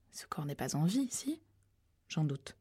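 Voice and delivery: hushed unvoiced whisper. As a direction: whisper it near the body. Characters whispering near a corpse. (chuchoté)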